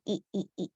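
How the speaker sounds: "E, E, E" softly repeated as a sound drill; quiet phonics demo. Shown as a demonstration. Teacher quietly drilling the letter sound for kids. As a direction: drop your voice and drill "E". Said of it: "this letter makes the E sound".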